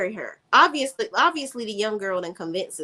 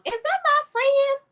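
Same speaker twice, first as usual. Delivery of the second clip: delighted greeting. Real, not acted. Host spotting a friend in the live chat, delighted high-pitched greeting.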